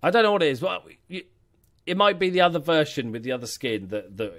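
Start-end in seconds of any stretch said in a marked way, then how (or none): none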